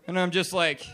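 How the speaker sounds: ordinary delivery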